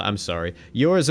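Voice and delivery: ordinary talk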